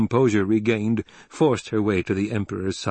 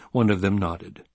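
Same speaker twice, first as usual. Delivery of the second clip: plain reading voice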